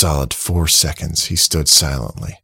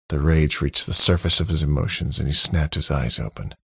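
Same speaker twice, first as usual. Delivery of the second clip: plain reading voice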